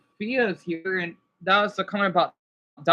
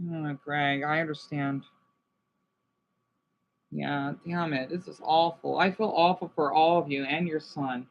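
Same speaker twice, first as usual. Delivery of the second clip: quiet grief; 6 semitones lower, 5 dB quieter than usual. Real, not acted. Subdued sympathy after sad news.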